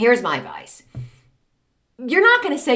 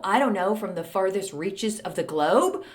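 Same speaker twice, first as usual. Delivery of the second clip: ordinary talk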